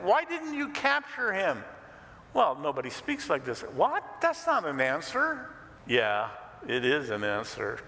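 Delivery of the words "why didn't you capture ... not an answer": indignant Pharisee. Acted. Pharisees scolding the officers.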